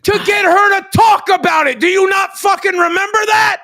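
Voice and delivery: high, emphatic, outraged shouting; angry yelling. Real, not acted. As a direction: yell it as proof they forgot. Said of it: "stop your fucking yelling at me".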